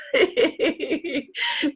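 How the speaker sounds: high, light giggle. Real, not acted. Coach laughing about losing her voice, amused giggle.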